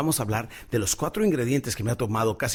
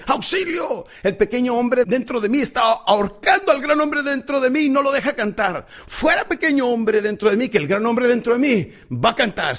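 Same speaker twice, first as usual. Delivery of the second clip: high, loud cry for help; desperate shout; 10 semitones higher, 6 dB louder than usual. Acted. Caruso bursting onstage, yelling high and loud.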